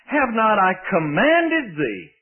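slow reading, COMMANDED leaned on hard; emphasis on COMMANDED. Real, not acted. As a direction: stretch out and punch the word "commanded". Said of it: "That's my emphasis".

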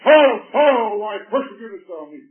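high, loud angry demand; in anger. Shown as a demonstration. Preacher showing how Jesus did not say it, angry. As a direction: bark the name out in wrath. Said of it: "didn't say in a tone of anger".

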